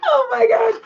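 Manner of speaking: high, loud laughing exclamation. Real, not acted. Reacting to her own slip, high laughing exclamation.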